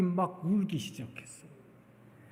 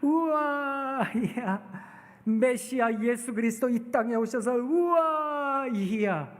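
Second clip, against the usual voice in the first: delighted wow; 4 semitones higher, slower than usual. Acted. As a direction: marvel with delight. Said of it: "하나님께서 우리를 바라보시고". (길게 끄는 감탄의 우와)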